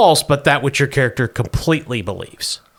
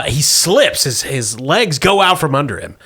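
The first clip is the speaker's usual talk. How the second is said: normal talking voice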